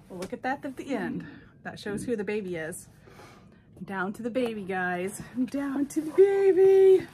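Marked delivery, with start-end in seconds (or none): 5.5-7.1 much louder, higher cheer; excited announcement. Real, not acted. Telling viewers the baby is next, loud excited cheer. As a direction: shout the big reveal.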